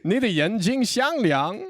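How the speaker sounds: high, loud put-on Mandarin speaker, hammed up; 夸张地模仿说中文; mock Mandarin. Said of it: "He just starts speaking fluent Mandarin".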